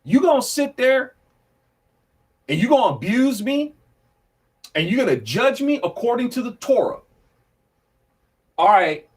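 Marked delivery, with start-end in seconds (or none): 0.0-7.0 indignant. Retold or imitated. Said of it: "Paul is basically telling them".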